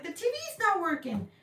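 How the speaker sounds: high mock whine; hammed-up high-pitched complaint; complaining mom. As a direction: imitate a mom wailing for help. Retold mom complains to dad.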